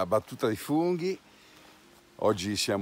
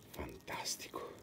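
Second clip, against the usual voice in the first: hushed awed whisper; 5 semitones lower, 14 dB quieter than usual. Real, not acted. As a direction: breathe it out in wonder. Awed whisper over a mushroom.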